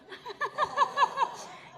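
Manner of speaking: high, loud burst of laughter. Real, not acted. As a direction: laugh at herself. Laughs at her cheap ring.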